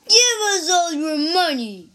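loud, high robber's yell; shouted. Acted. Robber ambushes the travelers on the road, shouting. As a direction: bellow a threat at the travelers. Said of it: "shouted one man".

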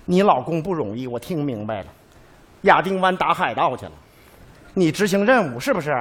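ordinary talk

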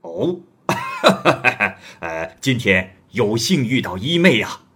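a stranger thrilled to meet a new sister; delighted laugh. A narrator's reading. Stranger laughing with joy at meeting Zhang. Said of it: "来人于是很高兴地说".

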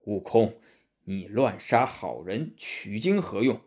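low, measured monk's voice; Tang Seng rebuking. Acted. Monk reproaches disciple for killing good people, low grave rebuke.

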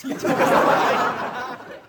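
breathless high giggle; a wheezing giggle at the joke; airy high laugh. Real, not acted. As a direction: laugh silently and airily. Laugh after punchline.

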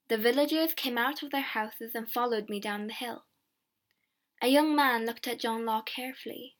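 ordinary delivery

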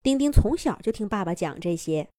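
plain reading voice

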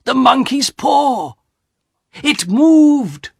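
high, loud startled cry; frightened cry. Acted. Old man crying out to his family in fright. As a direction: cry out as the paw twitches in your hand.

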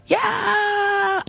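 high-pitched storybook scream held at modest volume. A narrator's reading. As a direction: let out a thin, drawn-out "yaaah". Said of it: "screamed Leslie".

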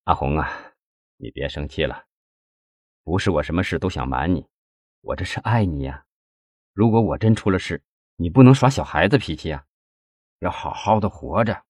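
low, soft pleading with his wife; subdued, sad tenderness; sorrowful. A narrator's reading. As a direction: console her with a heavy heart. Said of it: "难过地对杨红说".

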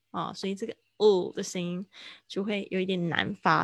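ordinary talk, nothing marked

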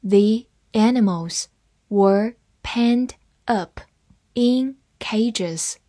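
slow, careful phrase-by-phrase reading; teacher slowing down so students can follow. Shown as a demonstration. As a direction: break it into chunks and read each one slowly. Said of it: "好，慢慢来读".

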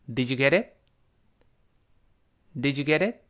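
"Did you get it?" lifting at the end; rising question tune. Shown as a demonstration. Teacher models a yes-no question, rising "Did you get it?". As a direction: link "Did you get it?" and let "it" rise. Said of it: "变成一个扬声升起来的这么一个一般疑问句".

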